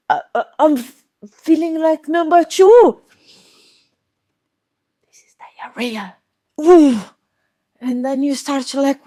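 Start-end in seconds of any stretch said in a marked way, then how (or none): 0.1-3.0 stammering, then high and loud; mock distress. Speaker acting traveller hit by urgent need, alarmed. Acting sudden stomach urge. 5.1-6.2 hushed whisper, much softer. Retold or imitated. Traveller realizing stomach trouble, whispered aside. 6.6-7.0 high loud ooh of discomfort; pained ooh. Retold or imitated. Traveller in taxi feeling cramps, pained ooh.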